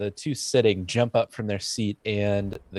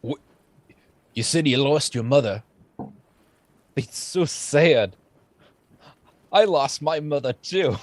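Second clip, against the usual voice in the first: mock sorrowful bandit; 5 semitones higher than usual. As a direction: overdo the sadness for comic effect. GM voices bandit.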